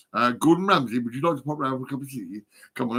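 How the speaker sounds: ordinary talk, nothing marked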